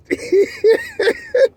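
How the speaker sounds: high wheezing laugh bursting out; burst of laughter. Real, not acted. Storyteller laughing at his own story, high cackle. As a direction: crack up at your own punchline.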